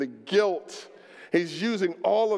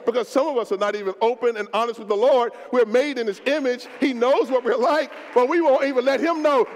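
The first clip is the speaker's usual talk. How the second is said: rising louder and higher; building sermon; 4 semitones higher, 4 dB louder than usual. Acted. Pastor building toward a cry to God.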